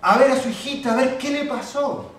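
loud, rising sermon delivery; fervent preacher building to a peak; impassioned preaching. Acted. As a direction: preach it with fire to the congregation. Preacher's story at full force.

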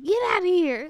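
mock yell, imitating someone in the background; imitating Paul. Host relaying husband's background yelling, loud imitation. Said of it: "Paul's in the background going".

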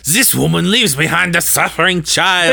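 put-on high, theatrical French accent. Valjean in imagined original cast, high French accent. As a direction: declaim it in an over-the-top French accent. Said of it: "the French accents had me crying".

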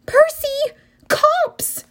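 high, urgent yell; yelling warning. Acted. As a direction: shout the alarm. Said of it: "Annabeth yelled".

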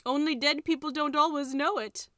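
high, loud, plaintive creature voice; wailing tiger skin. A narrator's reading. Tiger skin explains about dead people, wailing. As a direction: wail it out, pitched well up. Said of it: "the tiger skin wailed".

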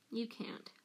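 soft, winded protest; breathless. A narrator's reading. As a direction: let it fall away, short of breath. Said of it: "he said breathlessly".

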